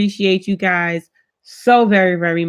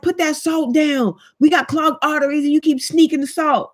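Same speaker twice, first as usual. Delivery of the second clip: scolding shout; 7 semitones higher than usual. Retold or imitated. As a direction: yell it like catching her red-handed. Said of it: "Or I'll be like".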